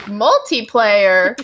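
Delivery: slow, loud incredulous question; surprised. Retold or imitated. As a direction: stretch it out in disbelief. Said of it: "and I was like".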